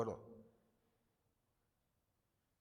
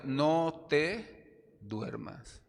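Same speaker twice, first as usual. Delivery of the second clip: drawn-out, very slow warning; mother ordering her son on the bus; stern order; 4 semitones higher, 5 dB louder, much slower than usual. Retold or imitated. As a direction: stretch each word as a warning. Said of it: "La orden fue".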